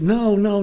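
high, insistent "No, no"; emphatic denial. Retold or imitated. Teacher refuses student's credit, emphatic denial.

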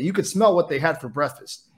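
usual speaking voice

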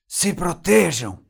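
urgent, drawn-out warning shout; loud, high cry to take cover; seu Madruga shouting. A narrator's reading. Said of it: "gritou seu Madruga".

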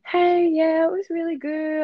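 high, chirpy mock-casual reply; put-on cheeriness. Retelling her answer to boyfriend while sulking, falsely cheery.

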